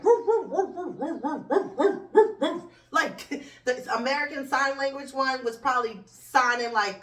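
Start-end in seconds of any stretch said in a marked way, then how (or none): 0.0-2.6 higher, louder yappy woof woof imitation; mock dog barking. Mocking debaters talking over each other, barking like dogs. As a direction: bark it out like dogs squabbling. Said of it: "It just sounded like".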